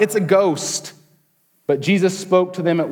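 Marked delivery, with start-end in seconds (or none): 0.0-0.9 mildly fearful cry, pitched higher. A narrator's reading. Disciples in the boat see Jesus walking on water, mild fearful cry. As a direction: read it as an understated, frightened cry. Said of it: "in their fear, they cried out".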